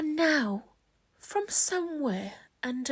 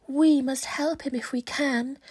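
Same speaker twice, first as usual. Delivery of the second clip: plain reading voice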